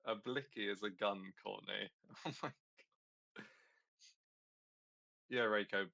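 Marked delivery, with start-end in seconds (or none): 2.0-3.4 laughing. Real, not acted. Laughing at the gun joke.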